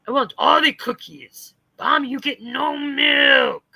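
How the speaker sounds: slow, hammed-up bellowing; mock tantrum. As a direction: wail it like a greedy cartoon brute. Same mock tantrum voice of her.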